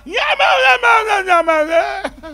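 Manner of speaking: loud, high wordless bleating; mute straining sounds. Retold or imitated. Struck-dumb priest trying to talk, loud high bleats. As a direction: try to speak but only strain out sounds.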